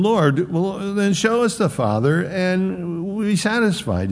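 high, eager plea; pleading disciple. Retold or imitated. Philip voiced, high pitched.

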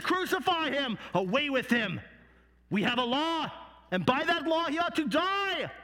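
high, vehement accusing cry; crowd crying out. Acted. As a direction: demand it as an angry mob. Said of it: "they began to cry out".

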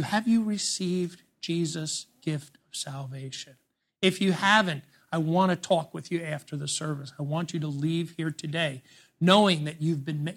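tender altar call. Real, not acted. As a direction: speak gently to one who needs saving.

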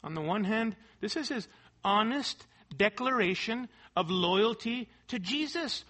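ordinary talk

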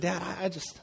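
a soft, lightly voiced sad little boy; imitating sad son. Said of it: "he said".

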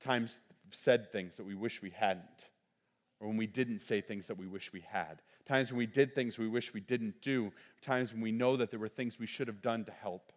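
hushed sermon reflection. Acted. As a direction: draw the congregation inward softly.

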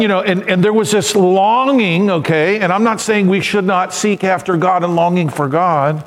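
usual speaking voice

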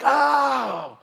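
high, loud cry to heaven; hammed-up driver's despair; exasperated shout. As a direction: shout it in mock agony. Acted frustrated driver cries to God.

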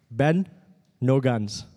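badly faked Indian accent for dad; lightly attempted, unconvincing Indian accent; horrible Indian accent. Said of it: "That was actually a horrible Indian accent".

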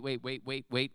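ordinary talk

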